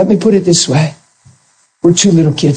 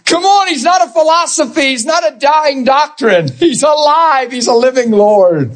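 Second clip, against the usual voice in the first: preaching at full force; 10 semitones higher than usual. Acted. Preacher crying out to the congregation about Christ's sacrifice. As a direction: cry it out to the congregation like a revival peak.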